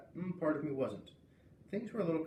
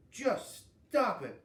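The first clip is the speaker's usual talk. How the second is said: higher, louder, slow and hard; exploding; 9 semitones higher, 6 dB louder, much slower than usual. A narrator's reading. Girl snapping at a mean classmate, exploding angry. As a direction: burst out furious. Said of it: "I exploded".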